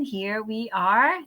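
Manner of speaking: ordinary delivery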